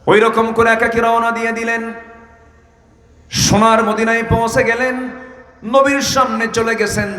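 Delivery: high, sustained preacher's chant; full-force preaching. Real, not acted. Preacher telling of Abdullah's night departure, impassioned preaching. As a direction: lift the story into a high sermon call.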